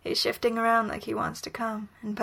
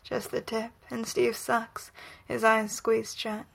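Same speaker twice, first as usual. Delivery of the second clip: plain reading voice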